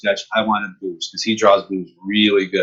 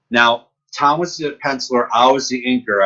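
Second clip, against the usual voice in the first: ordinary talk, nothing marked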